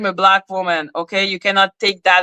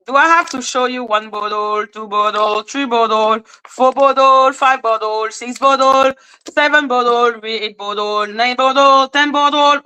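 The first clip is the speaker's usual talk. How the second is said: higher, singsong counting routine; mocking count; 4 semitones higher than usual. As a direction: count them off with exaggerated exasperation. Mockingly counting bottles for critic.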